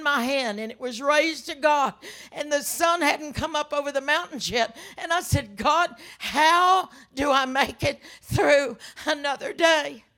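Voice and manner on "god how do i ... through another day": loud, drawn-out plea to God; anguished prayer. Retold or imitated. Widow with coffee cup raised to God, anguished plea. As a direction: cry out in grief, pushing each word.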